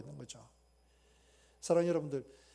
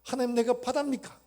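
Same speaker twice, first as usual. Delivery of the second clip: loud, high crying-out to God; anguished lament; 8 semitones higher, 8 dB louder than usual. Retold or imitated. Job crying out to God, loud anguished lament. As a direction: cry out in anguish. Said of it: "그렇게 탄식하면서".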